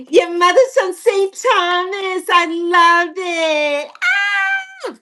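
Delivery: high, slow delighted cries. Real, not acted. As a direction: burst out with joy, then squeal. Delighted at the news.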